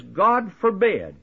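slow, high, categorical refusal; emphatic denial. Acted. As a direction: quote it as a firm, drawn-out denial. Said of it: "an emphatic and categorical denial".

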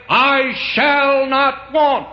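very high, loud, very slow sung-out declaration; voice of experience. Shown as a demonstration. Preacher crowning his testimony to the church, very high and slow. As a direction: ring out I shall not want as the climax. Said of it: "That's the voice of experience".

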